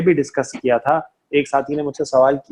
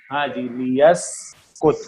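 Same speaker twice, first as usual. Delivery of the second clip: ordinary delivery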